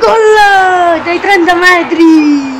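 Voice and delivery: high excited goal call; breathless italian goal commentary. Acted. Playing the match, scoring goal. (telecronaca del gol concitata)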